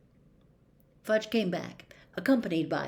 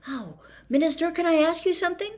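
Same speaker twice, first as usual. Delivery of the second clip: higher, quick hopeful ask; eager; 10 semitones higher, 6 dB louder, faster than usual. A narrator's reading. Harry asking the Minister, eagerly. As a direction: seize the chance.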